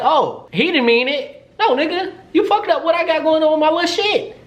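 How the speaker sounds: high-pitched sassy tirade, climbing as it goes; indignant rant. Retold or imitated. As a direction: go off on him in mock outrage. Acted rant at Zay, still mad.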